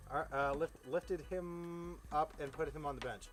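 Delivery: plain reading voice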